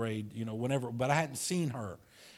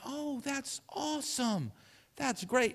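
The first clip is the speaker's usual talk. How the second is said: put-on delighted old teacher; 12 semitones higher, 4 dB quieter, slower than usual. Imitating teacher's delighted reply.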